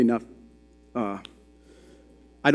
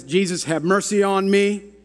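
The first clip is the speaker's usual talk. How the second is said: raised, high-pitched plea, short of a scream; mock cry out; 7 semitones higher than usual. Blind man screams out to Jesus, a high retold plea. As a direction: call out for mercy in a retold cry. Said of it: "The blind man that screams out".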